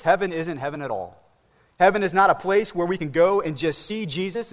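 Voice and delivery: usual speaking voice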